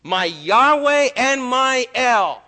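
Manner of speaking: slow, loud, high cry of awe; drawn-out loud worshipful cry. Retold or imitated. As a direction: cry it out on your knees. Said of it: "he cried".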